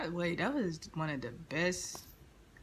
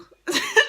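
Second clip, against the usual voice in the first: high burst of laughter; 11 dB louder than usual. Real, not acted. Laughing at retold insult.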